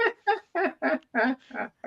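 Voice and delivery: real high-pitched burst of laughter; amused laugh. Real, not acted. Teased about being tested in Pidgin English, laughing.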